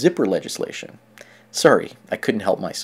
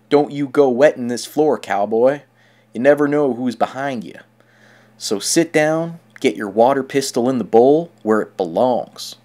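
loud, drawled Western cowboy twang; 4 dB louder than usual. Pretend. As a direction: play a gruff Wild West sheriff. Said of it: "in a cowboy voice that's gonna say".

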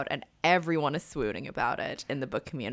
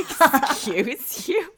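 slow, high and louder, offended "excuse you"; indignant retort; 9 semitones higher, 5 dB louder, much slower than usual. Pretend. Stranger offended by a proverb, slow high indignant retort. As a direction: stretch it out like someone insulted by a compliment. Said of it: "They'll be like".

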